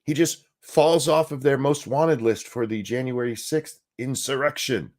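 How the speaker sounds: usual speaking voice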